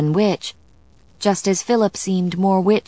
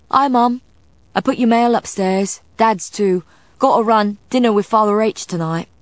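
high, loud son dashing past; hurried son Jack; 5 semitones higher, 3 dB louder than usual. A narrator's reading. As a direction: rattle off news to mum on the run. Jack pelts downstairs, greets mother.

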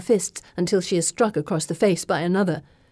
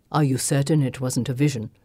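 lower, calm male voice; low young man voice; 6 semitones lower than usual. Acted. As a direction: voice him low and steady. Kartik voiced by female narrator.

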